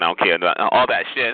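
low imitation of a caller; mock chat-line talk. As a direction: mimic the caller deep in the throat. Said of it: "When they get up on your phone".

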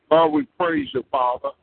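ordinary talk, nothing marked

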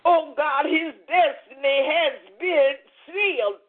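ordinary delivery

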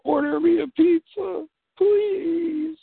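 put-on very high, whiny-child begging; pleading. Grown child phoning mom for pizza, pleading. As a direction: beg Mom in an exaggerated high voice.

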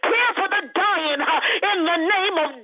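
fervent prayer. Real, not acted.

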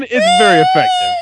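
high excited shout. Pretend. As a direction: belt it out like a trailer line. Hyped movie quote shouted.

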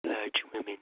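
whispered sleep-talk. Acted. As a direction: murmur it half asleep. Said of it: "I fell asleep there".

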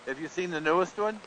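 ordinary delivery

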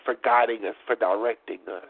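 ordinary delivery

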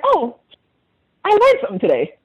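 high-pitched imitation of a surprised learner; mock realisation. Caller mimicking a coworker who learned a word, surprised. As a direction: pitch it way up, mimicking sudden discovery.

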